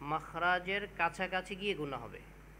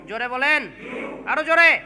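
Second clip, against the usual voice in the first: loud teacher's call, building higher; urging louder; 7 semitones higher, 16 dB louder than usual. Real, not acted. Teacher pushing students to speak up, loud rising call. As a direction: call out to the whole class, rising in force. Urges class to repeat louder.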